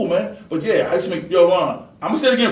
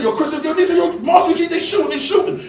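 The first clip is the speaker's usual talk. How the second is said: higher, urgent mock yell; retold alarmed shout; 10 semitones higher than usual. Warning Chris about gunfire, urgent higher shout. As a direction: call out the shooting in alarm. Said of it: "I said".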